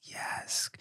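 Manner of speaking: barely audible breathy whisper; hushed whisper. Retold or imitated. Baby recognising father's voice, a faint whisper. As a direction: breathe it out in quiet relief. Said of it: "it just was like".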